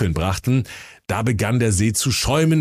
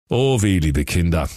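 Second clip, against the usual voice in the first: ordinary delivery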